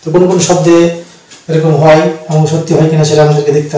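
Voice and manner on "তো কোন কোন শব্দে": ordinary talk, nothing marked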